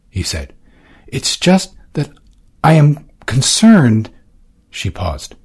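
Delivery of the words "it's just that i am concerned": higher, slow, anxious voice; worried young woman. Acted. Valentine confiding her worry to Orsino, slowly. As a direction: edge into the worry carefully.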